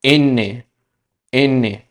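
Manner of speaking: एने as Spanish "ene"; Spanish letter name. Shown as a demonstration. Teacher to students, careful Spanish letter name. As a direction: model एने clearly. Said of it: "एन को क्या कहते हैं".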